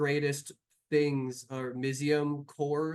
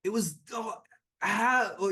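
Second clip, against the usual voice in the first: stunned dismay; 7 semitones higher than usual. Acted. NPC stunned by loss.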